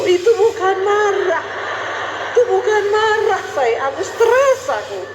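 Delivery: retold frustrated outburst; exasperated protest. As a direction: protest in a high, strained-out complaint. Acted exasperated reply to husband.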